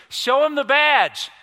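slow, high, very loud holler; yelled punchline. Retold or imitated. Farmer yelling to the officer chased by a bull, hollering. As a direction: yell it out across a pasture. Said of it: "he yelled these words".

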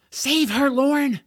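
high, loud call for rescue; urgent cry. Acted. George ordering his dragon to save Deirdre, urgent.

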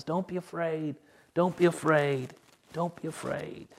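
quiet, tender Don't be afraid; soft reassurance. Acted. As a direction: lay each one down softly like a comfort. Jesus' repeated comfort, softly voiced.

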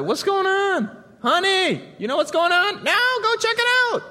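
high, loud call to the spouse inside; curious call. Pretend. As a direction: call out to your honey, wondering what the noise is.

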